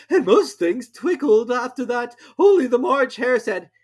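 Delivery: high slow Hatter voice; nervous Hatter. A narrator's reading. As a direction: ramble on anxiously. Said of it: "the Hatter went on".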